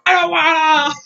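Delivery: hammed-up cartoon protest; whiny refusal. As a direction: wail it like a defiant child. Peridot refusing to stop driving.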